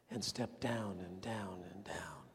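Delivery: low, slow whisper sinking down; descending whisper. Real, not acted. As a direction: let the voice sink as he steps down. Christ's descent from glory.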